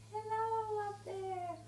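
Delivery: high, slow sung-out call; long falsetto greeting, sliding down. Shown as a demonstration. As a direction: call up to someone very tall. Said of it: "so you go".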